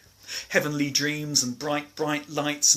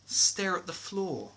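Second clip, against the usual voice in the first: ordinary delivery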